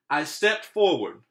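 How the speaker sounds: ordinary delivery, nothing marked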